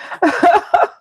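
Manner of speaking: high-pitched burst of laughter; amused laugh. Real, not acted. Mom tickled after tasting the drink, high laugh.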